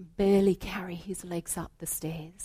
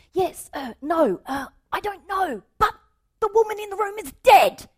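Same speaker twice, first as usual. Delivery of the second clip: high, loud and fast, breaking; blubbering panic; 11 semitones higher, 7 dB louder than usual. Acted. Salesman blurting to the manager, blubbering and panicked. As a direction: blurt it out in a total mess. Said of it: "a complete blubbering mess".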